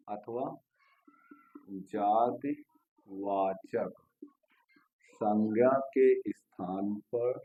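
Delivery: very slow, word by word with long pauses; stretched words, spaced far apart; slow dictation. Real, not acted. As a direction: dictate while writing it out.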